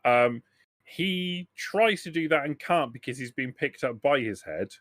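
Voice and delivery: usual speaking voice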